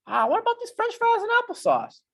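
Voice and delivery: a cartoonish little voice begging for junk food; hammed-up squeak asking for fries; craving brain. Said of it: "Plucks you in the back of the head again".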